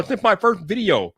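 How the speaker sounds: normal talking voice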